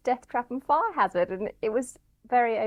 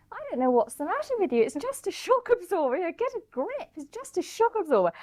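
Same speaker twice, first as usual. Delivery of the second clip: higher-voiced, impatient best friend; brisk mock telling-off, pitched up; impatient Jennifer; 9 semitones higher than usual. Friend brushing off her tears over a shock absorber, in a higher impatient voice. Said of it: "Jennifer said to me".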